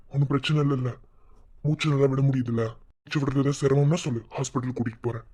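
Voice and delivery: normal talking voice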